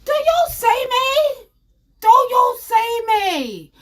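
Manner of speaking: high, slow, loud plea; pleading. Pretend. Comic asking the crowd, high slow pleading. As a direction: beg the crowd to look.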